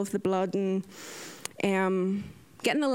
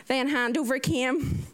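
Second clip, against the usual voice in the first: normal talking voice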